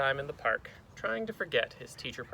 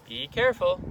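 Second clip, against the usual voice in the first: louder, higher warning; raised teacherly warning; cautioning teacher; 4 semitones higher, 7 dB louder than usual. Acted. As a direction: call out a caution to a pupil. Teacher warns boy.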